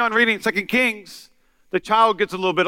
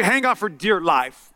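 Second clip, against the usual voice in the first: ordinary delivery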